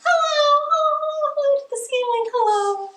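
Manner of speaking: squeaky high hellos up to the ceiling. Shown as a demonstration. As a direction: reach the ceiling with your voice. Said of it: "as high as we can".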